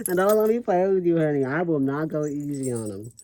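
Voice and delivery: lightly hammed-up diva swagger; sassy diva voice. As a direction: strut it like a boss bitch. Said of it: "When I'm here like this".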